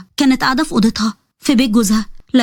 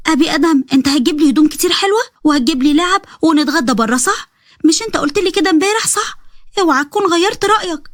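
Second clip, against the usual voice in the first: put-on high childish pleading voice; little girl voice; 6 semitones higher than usual. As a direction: sound like an eager little girl asking her brother. Narrator voices little girl Sahar.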